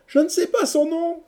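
very high, loud cry of helplessness; lovelorn lament. A narrator's reading. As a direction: wail it at the top of your range. Lovesick hero laments to friends. (plainte suraiguë et désemparée)

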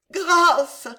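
high, loud cry; anguished shout for mercy; begging for mercy. A narrator's reading. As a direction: implore in desperation. Alice falls to her knees.

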